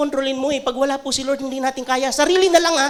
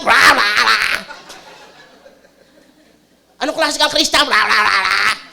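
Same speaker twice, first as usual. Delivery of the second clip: mock nagging babble; 4 semitones lower, 4 dB louder than usual. Mocking a nagging Facebook friend.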